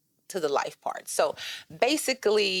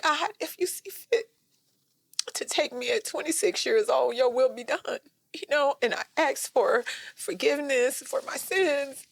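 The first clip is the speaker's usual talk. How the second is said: choked, crying plea to God; much higher, tearful surrender; tearful prayer; 7 semitones higher than usual. Retold or imitated. Reliving her deathbed prayer, voice breaking with tears. Praying for forgiveness while dying.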